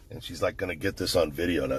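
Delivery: usual speaking voice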